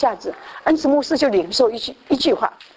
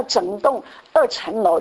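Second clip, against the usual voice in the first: usual speaking voice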